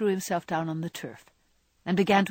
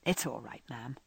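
lower, softer, gently reassuring; soft and low, reassuring a worried friend; reassuring, as to her mother; 6 semitones lower, 9 dB quieter than usual. A narrator's reading. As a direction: settle her worries like a dutiful boy. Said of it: "just as he used to say it to her mother".

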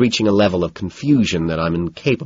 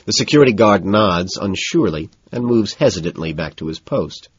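ordinary delivery, nothing marked

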